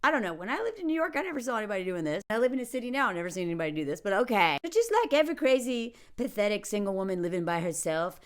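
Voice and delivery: usual speaking voice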